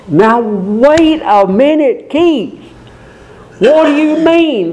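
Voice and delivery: doubting listener. Acted. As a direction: push back on Keith like a skeptic in the pews.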